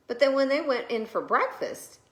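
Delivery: ordinary delivery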